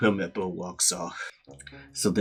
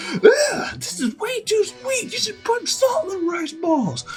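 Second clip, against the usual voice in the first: very high whiny voice with a groan of disgust; disgusted spoiled brat; 4 dB louder, slower than usual. Retold or imitated. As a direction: spit out the food like a snob. Helmeppo disgusted by rice ball.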